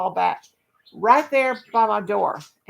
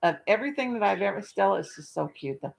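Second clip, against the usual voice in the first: normal talking voice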